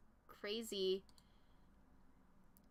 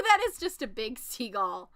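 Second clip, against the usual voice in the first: usual speaking voice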